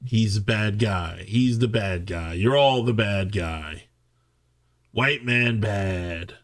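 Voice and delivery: slow, low, dumbed-down parody; mocking. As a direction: drag it out slowly like a simplistic slogan. Mock summary of the film's message.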